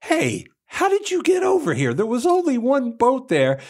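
astonished crowd. Retold or imitated. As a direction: squeak it out in disbelief. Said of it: "And they go".

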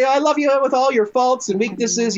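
high, lilting declaration of love; playful high-pitched adoration; mock gushing newlywed. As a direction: lightly gush like a starry-eyed newlywed. Continues gushing newlywed after the song.

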